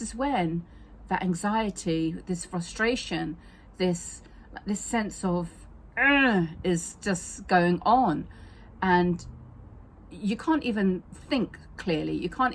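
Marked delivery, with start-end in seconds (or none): 6.0-6.5 a sudden loud exasperated ugh; frustrated groan. Retold or imitated. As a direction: let out a fed-up groan. Frustration shown in the middle of talk.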